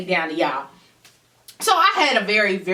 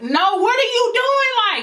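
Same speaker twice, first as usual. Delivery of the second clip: much higher, slow and insistent; frantic demand; 14 semitones higher than usual. Retold or imitated. Demanding answers from boyfriend on the phone, high slow frantic demand. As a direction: pin him down before he hangs up.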